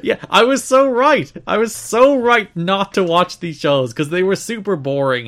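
loud, high smug gloat. Real, not acted. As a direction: crow about being right. Gloating at being right.

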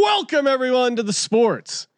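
big, punchy podcast host welcome; hyped show intro. Acted. Host introducing his partner in picks, hyped intro. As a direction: stretch the nicknames and greeting like a ring announcer.

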